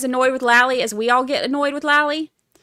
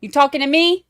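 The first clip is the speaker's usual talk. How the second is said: louder Boston accent, higher, rising on me; 6 semitones higher, 8 dB louder than usual. Shown as a demonstration. Answering a viewer's accent request, Boston accent. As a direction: throw out a quick Boston accent. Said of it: "in a Boston accent?".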